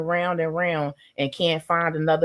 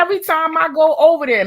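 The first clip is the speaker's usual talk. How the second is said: excited rush; 9 semitones higher, 6 dB louder, faster than usual. Real, not acted. Excited retelling.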